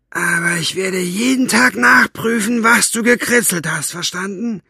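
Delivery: high, loud menacing yell; threatening shout. Acted. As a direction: bark the threat. Buster threatening.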